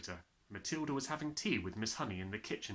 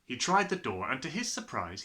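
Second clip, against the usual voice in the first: usual speaking voice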